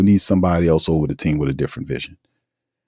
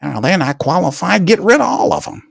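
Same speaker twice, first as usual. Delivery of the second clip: much higher, hammed-up tyrant winding up to a purge; tyrant voice, building; 12 semitones higher than usual. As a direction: repeat it more outraged, climbing up. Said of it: "you'd be a tyrant talking like that".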